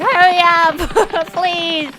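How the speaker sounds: high, loud mock yell; impatient inner outburst. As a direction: burst out as exasperated inner pleading. Acts out her impatient inner voice.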